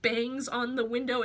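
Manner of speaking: usual speaking voice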